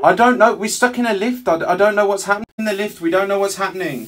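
loud, high, urgent plea; panicked. Real, not acted. Stuck caller to lift operator, panicked.

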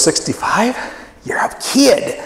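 put-on high, breathy scoff at a young age; mock older churchgoer. Older member scoffing at the pastor's age, mock disbelief. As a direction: tease him as just a kid. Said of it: "I hear someone like that laugh, who goes".

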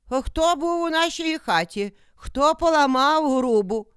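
high, loud questioning; indignant goats. A narrator's reading. Goats question who was in their house, high-pitched and indignant. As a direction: demand answers in a high voice.

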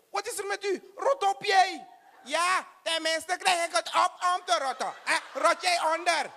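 high, indignant shouting at the clerk; offended yelling. Acted. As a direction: bristle at the mangled name, yelling high. Passenger yells at the clerk. (hoog en verontwaardigd schreeuwen tegen de baliemedewerkster)

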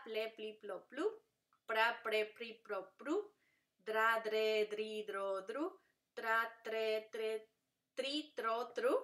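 "Fra, fre, fri, fro, fru" runs, loud start and rising end; each cluster like "tra" one unbroken syllable; tongue-twister drill. Shown as a demonstration. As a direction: chant "gla", "pla", "dra" series in even beats. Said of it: "es un trabalenguas".

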